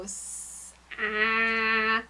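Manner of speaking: "S-, ah." as a hissed s then a separate short ah; a child sounding out s and a, one by one; sounding out slowly. Shown as a demonstration. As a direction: stretch the s, then pop the "ah". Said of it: "And they go".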